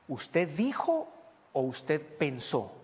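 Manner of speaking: drawn out, slow, probing question; slowly and deliberately ask him to think; slow searching challenge; slow pointed question. Retold or imitated. Retold pointed question to woman.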